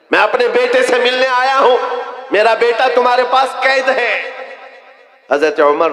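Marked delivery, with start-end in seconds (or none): 0.1-4.2 pleading visitor. Acted. Umair pleads to see his son.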